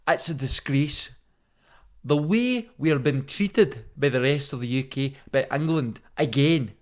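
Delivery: loud, outraged Scottish rant; angry Scottish accent. Pretend. Podcaster mimicking an angry Scottish podcast, loud Scottish rant. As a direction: rail like an aggrieved Scottish patriot. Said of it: "I could be like".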